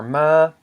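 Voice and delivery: ma lifted high and held; long high-tone ma. Shown as a demonstration. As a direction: say ma loud on a high pitch. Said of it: "this equals a high tone".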